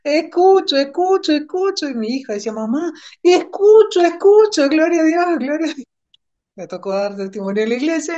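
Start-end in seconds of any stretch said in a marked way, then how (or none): 0.0-1.9 joyful shouting. Retold or imitated. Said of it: "y me puse a gritar como loca". 2.5-5.9 daughter's joyful cry. Retold or imitated. Daughter echoes mother's joyful cry.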